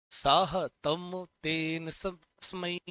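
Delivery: slow, deliberate chant of साहतम तेन तस्मै; slow recitation. Shown as a demonstration. Teacher prompting students to repeat, chanting slowly. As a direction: lead the class through it slowly. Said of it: "बोलो, बोलो".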